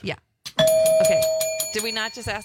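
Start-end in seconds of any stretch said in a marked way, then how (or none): 0.6-1.2 loud, high, disbelieving okay; excited shout. Real, not acted. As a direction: yelp out the okay. Stunned by a repeated question.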